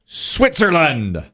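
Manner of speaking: high, drawn-out announcer call; game show reveal call. Real, not acted. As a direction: stretch it out for the big reveal. Host calls for the board reveal.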